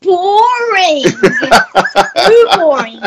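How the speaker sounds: giggling through the words; laughing. Real, not acted. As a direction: laugh out the word boring. Child laughing through answer.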